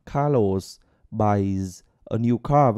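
each word separate, very slow; slow unlinked demo. Shown as a demonstration. Teacher shows how he spoke without linking, very slowly. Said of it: "nhưng mà mình có nối đâu".

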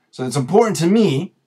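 ordinary talk, nothing marked

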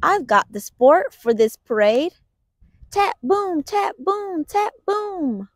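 usual speaking voice

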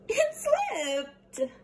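high crying excuse; crying child. Acted. David cries over spilled juice, high voice. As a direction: whimper it like a kid.

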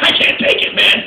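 loud, desperate cry of surrender; frustrated outburst. Retold or imitated. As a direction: blurt it out like you're cracking. Stand-up, stuck on conveyor belt.

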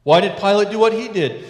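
usual speaking voice

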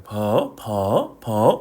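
퍼 low, then swept upward; question-mark tone. Shown as a demonstration. Teacher drilling the tone, 퍼 dipping then rising. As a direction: repeat 퍼 with a questioning rise. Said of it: "이렇게 되는 거예요".

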